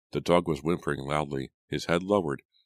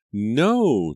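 loud, high, drawn-out no; horrified cry; 8 semitones higher, 8 dB louder than usual. A narrator's reading. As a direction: cry out in horror. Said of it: "Julia cried in horror".

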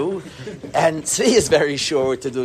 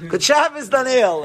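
called out; 9 semitones higher, 3 dB louder than usual. Retold or imitated. Calling to the one far back.

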